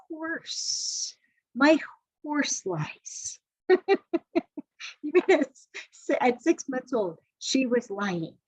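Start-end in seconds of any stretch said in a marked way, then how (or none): 3.7-5.0 high laugh. Real, not acted. Laughs at horse lying.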